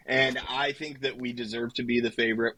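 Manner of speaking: ordinary delivery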